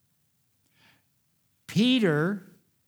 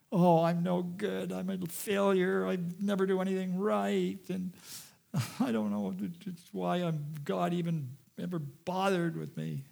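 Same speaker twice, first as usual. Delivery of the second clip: acted tearful lament, held back in volume; weeping self-reproach; 3 semitones higher, 5 dB quieter than usual. Peter weeps outside after disowning Jesus, tearful self-reproach. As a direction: moan it softly, as if weeping in despair. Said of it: "He just wept".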